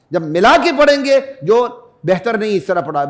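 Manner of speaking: ordinary talk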